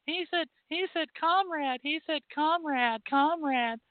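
shrieking accuser, hammed-up; mock hysterical critic. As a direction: squeal it like a scandalised critic. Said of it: "run off and scream and yell".

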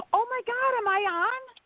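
breathless, high-pitched disbelief; excited gasp. Real, not acted. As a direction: gasp and squeal like a shocked caller. Caller surprised to be on air.

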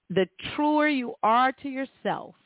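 ordinary delivery

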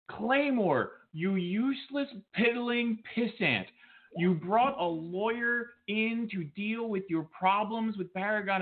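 high, slow, sneering taunt; wrestling promo trash talk. Acted. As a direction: draw out each insult slowly.

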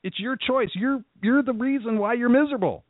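high-pitched blunt verdict; blunt callout. Retold or imitated. Coach telling a vice president he chose misery, high. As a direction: hammer the point home. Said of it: "I'm like".

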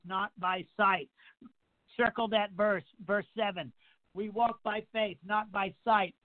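usual speaking voice

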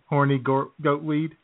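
ordinary delivery

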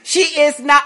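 ordinary talk, nothing marked

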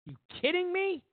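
high, incredulous squeal; disbelieving. Real, not acted. Mocking the restaurant parties, a high disbelieving squeal. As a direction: ask it in utter disbelief.

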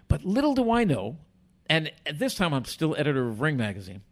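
ordinary talk

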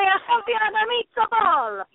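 high, slow cartoon Italian. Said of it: "like the character from Lady and the Tramp".

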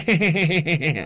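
high put-on giggle in her voice; mock giggle. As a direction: cackle like the politician you mock. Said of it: "I wonder if she giggled".

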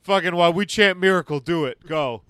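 loud drill-sergeant orders; coach yelling. Pretend. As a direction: bark it at the players.

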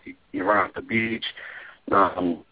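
ordinary talk, nothing marked

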